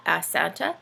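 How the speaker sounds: ordinary delivery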